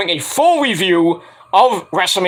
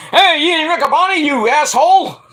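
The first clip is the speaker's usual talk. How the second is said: mock rant; 9 semitones higher, 3 dB louder than usual.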